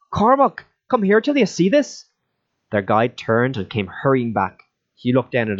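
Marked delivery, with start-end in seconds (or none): 0.1-2.1 high, louder call across the camp. Acted. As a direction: raise your voice to summon a friend. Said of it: "He lifted his voice".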